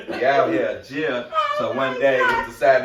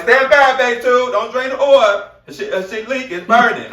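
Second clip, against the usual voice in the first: higher, louder alarmed call; urgent warning yell; 10 semitones higher, 7 dB louder than usual. Retold or imitated. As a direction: shout a warning before the oil drains. Shouting warning at coworkers.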